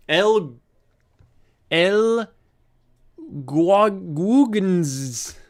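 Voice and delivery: struggling with the name, dragging out GUAGUGENS; tongue-tied. Real, not acted. Host failing to form a viewer's name, tongue-tied on GUAGUGENS. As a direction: mouth that can't form GUAGUGENS, slow and garbled. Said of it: "My mouth physically can't form".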